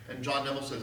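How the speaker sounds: ordinary delivery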